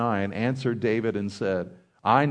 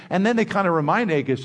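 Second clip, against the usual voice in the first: normal talking voice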